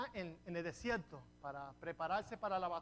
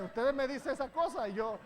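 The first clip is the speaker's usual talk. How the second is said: laughing; 4 semitones higher, 6 dB louder than usual. Real, not acted. Laughs at his own joke.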